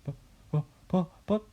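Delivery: high, halting stutter; stammering. A narrator's reading. George's mother seeing the giant hen, high stammer. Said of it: "stammered George's mother".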